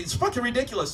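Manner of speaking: ordinary talk, nothing marked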